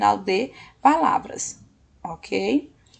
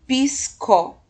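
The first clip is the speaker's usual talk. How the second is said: PISCO split as PIS... CO; two separate beats on PISCO; syllable by syllable; 3 semitones higher than usual. Shown as a demonstration. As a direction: hold the S in PISCO, then CO apart. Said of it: "acrescentar o S na primeira sílaba".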